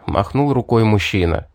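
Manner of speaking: usual speaking voice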